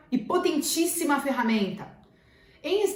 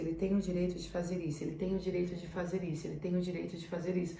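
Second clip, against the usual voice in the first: much softer, lower and faster, repeated like a chant under the breath; fading murmured mantra; 6 semitones lower, 12 dB quieter, faster than usual. Shown as a demonstration. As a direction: murmur it over and over until it fades. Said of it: "como um mantra". (mantra murmurado e repetido, cada vez mais baixo)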